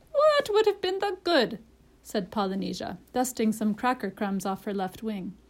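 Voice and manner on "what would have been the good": high, strained bird voice; parrot, dismissive. A narrator's reading. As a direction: toss the question back, high-pitched.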